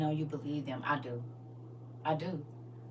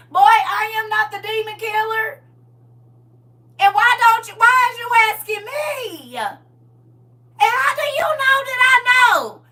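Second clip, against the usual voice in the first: high, loud fed-up yelling; aunt shouting at the pestering nephew; exasperated shout; 15 dB louder than usual. Real, not acted.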